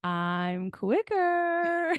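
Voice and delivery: put-on high, slow, whiny kid; little kid voice. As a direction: play a bratty little kid. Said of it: "it was just so, like, little kid".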